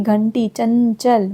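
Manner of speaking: घंटी, चंचल said slowly with a clear nasal n before the consonant; drawn-out nasal teaching read; slow nasal demo. Shown as a demonstration. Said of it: "न, न की sound जैसे आती है".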